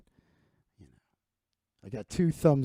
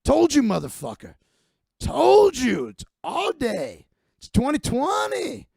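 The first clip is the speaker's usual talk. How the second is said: higher and louder, triumphant mock yell; gloating yell; 8 semitones higher, 4 dB louder than usual. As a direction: crow over the body like a hammed-up thug. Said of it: "And then yells at his corpse".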